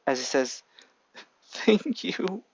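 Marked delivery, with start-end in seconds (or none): 1.5-2.4 high, tearful thank you. A narrator's reading. Son thanking his mother, tearful. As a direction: thank her through tears. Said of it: "Izuku cries".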